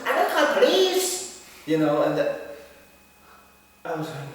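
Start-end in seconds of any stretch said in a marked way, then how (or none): 0.0-1.4 high, pleading cry; high plea. Retold or imitated. Next person begging him to leave, a high, pleading cry. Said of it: "the next one was".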